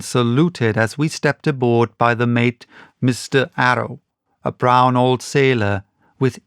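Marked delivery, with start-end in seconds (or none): none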